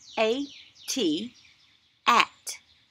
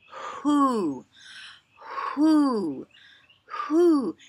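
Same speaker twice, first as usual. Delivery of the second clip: who hooted higher and louder like an owl; 5 semitones higher, 4 dB louder, much slower than usual. Shown as a demonstration. Teacher hooting who like an owl for children. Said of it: "That sounds almost like an owl".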